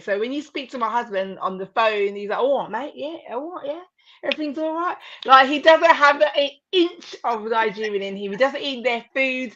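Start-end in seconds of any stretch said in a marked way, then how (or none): 2.4-5.0 lightly put-on British mate chat. Wife imitating her British husband on the phone, very British. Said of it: "as British as they can get".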